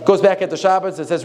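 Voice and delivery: normal talking voice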